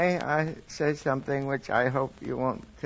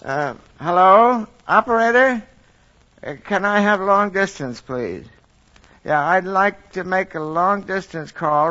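louder, raised voice into the phone; long distance phone call; 2 semitones higher, 6 dB louder than usual. Acted. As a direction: shout down a long line. Santa phones the operator.

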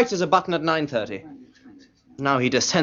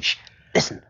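urgent shush; hushing whisper. Acted. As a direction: whisper it to silence your companion. Hushing colleague outside a locked door.

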